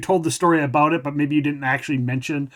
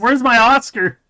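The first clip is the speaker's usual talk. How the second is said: mock diva; 8 semitones higher, 7 dB louder than usual. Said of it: "mock diva".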